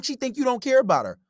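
normal talking voice

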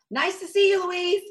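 high, loud social send-off; loud high goodbye. Acted. Demetria pushing Louise's visitor out the door, loud goodbye. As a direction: call out a big high-pitched farewell.

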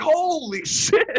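drawn-out, high-pitched exclamation with a laugh; retold yell. Co-host recalling his reaction to the tank scene, retold yell. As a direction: re-enact an amazed yell, stretched and high. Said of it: "I, like, yelled".

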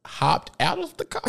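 high, laughing through the words. Real, not acted. Storyteller laughing while telling the story.

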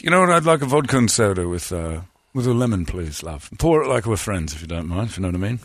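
put-on British customer ordering; British accent. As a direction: order a drink in a chummy mock British accent. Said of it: "unbelievable accent I've ever heard".

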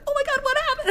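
imitating a friend jolted by a scream; startled friend. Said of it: "Caitlin was like".

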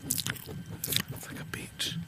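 slow, soft ASMR whisper. Pretend. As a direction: whisper it slowly into the mic. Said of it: "Do some A S".

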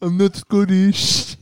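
slow, badly faked Scottish accent. Phone-in winner denying being Scottish, bad Scottish accent. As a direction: drag it out in a dodgy Scottish accent. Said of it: "that's not a Scottish accent".

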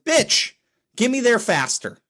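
high, frustrated yell at the app; exasperated outburst. Pretend. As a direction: snap at the navigator like it's a person. Yelling at Google Maps route.

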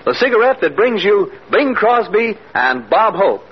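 ordinary talk

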